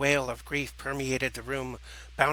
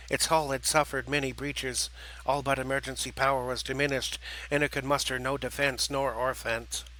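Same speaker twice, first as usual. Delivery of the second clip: ordinary delivery, nothing marked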